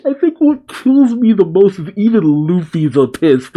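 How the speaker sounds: high-pitched, still cracking up; amused, voice lifted by laughter; talking through laughter. Real, not acted. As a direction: fight down a laughing fit while explaining. Recovering from long laughing fit.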